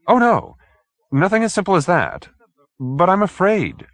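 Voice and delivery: high, slowing into a fearful admission; frightened little man. Acted. Hoag admitting fear to the detective, high and anxious. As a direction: confess the fear at the end.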